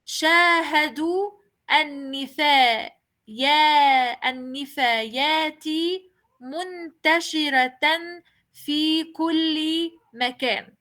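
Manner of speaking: شاهدوا النفايات منتشرة في كل مكان with long pauses, منتشرة ending in h; pausing reading. Shown as a demonstration. Teacher showing a pause before reading on. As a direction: stop after منتشرة, say it muntashira. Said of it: "voy a pronunciar esta frase en dos maneras".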